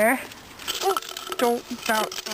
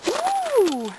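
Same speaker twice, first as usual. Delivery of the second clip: high ooh. Real, not acted. Reacting to puppy.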